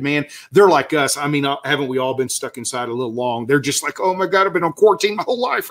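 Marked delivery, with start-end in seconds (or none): 4.0-5.7 mock frantic bird. Voicing restless birds' complaint.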